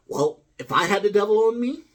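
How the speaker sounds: high, loud devil on the shoulder; hammed-up devil, pitched high and pushed loud. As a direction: pipe up like a devil on someone's shoulder. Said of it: "that's what I would sound like".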